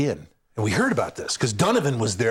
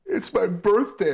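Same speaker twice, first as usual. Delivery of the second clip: higher, slow, mock-sobbing heckler through laughter; mock crying; 6 semitones higher, slower than usual. Ejected heckler sulking to the comedian, mock crying. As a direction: whimper it like a sulking drunk. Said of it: "he starts to cry".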